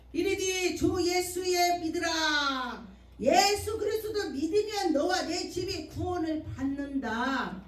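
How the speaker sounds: loud, slow preached scripture; full-force preaching. Acted. Preacher proclaiming scripture to the congregation, loud slow preaching. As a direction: proclaim it from the pulpit.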